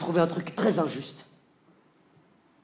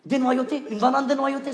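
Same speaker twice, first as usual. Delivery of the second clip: incredulous; 6 semitones higher than usual. Acted. Incredulous repeat of wife's request.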